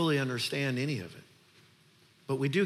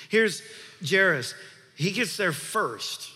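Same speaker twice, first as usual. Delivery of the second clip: louder, higher build over the music; impassioned sermon climax, higher and louder; preaching at full force; 5 semitones higher, 4 dB louder than usual. Acted. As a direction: drive the contrast home like a revival preacher.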